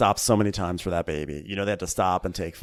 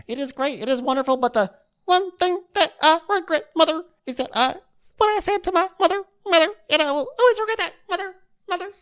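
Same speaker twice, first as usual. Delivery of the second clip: high, wailing mock regret voice; mock weepy Deandra; 3 dB louder, faster than usual. Imitating Deandra's tearful TV apology in a high mock wail. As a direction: whine it out like a sobbing socialite.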